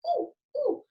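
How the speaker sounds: high squeaky monkey hoots; playful monkey ooh-ooh; monkey call. Shown as a demonstration. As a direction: hoot like a monkey. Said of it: "think about what sounds those animals make".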